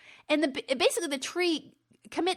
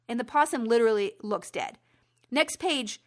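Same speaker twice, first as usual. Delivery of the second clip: ordinary talk, nothing marked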